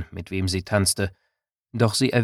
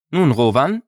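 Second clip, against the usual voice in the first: stern voice; 5 semitones higher, 6 dB louder than usual. A narrator's reading. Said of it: "begann sie mit strenger Stimme".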